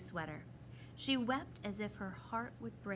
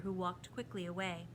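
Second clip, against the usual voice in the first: plain reading voice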